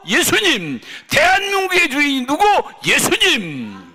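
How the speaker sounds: high, loud calls to the crowd; rising call and response. Real, not acted.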